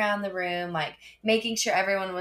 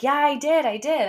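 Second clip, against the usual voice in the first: higher, louder playful boast; cheeky insistence; 7 semitones higher, 5 dB louder than usual. Retold or imitated. Student teasing his teacher about the shoes, cheeky and raised. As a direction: insist on it, grinning.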